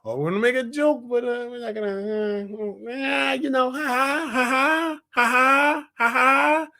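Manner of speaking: hammed-up feeble comedian; mock timid joking. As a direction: hem and haw, then force a ha-ha. Mimics timid, safe jokes.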